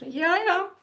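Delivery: very high, hammed-up tearful reply; mock crying. Tearful mom answering the doctor, mock crying. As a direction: imitate yourself squeaking through tears. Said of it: "I'm crying at this stage".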